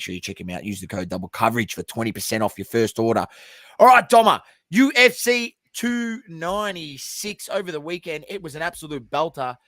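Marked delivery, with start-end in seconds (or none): 3.8-9.6 excited. Real, not acted. Hyped segment opener.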